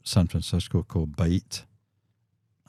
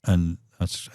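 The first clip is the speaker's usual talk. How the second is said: ordinary talk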